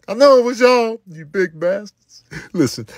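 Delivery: ordinary talk, nothing marked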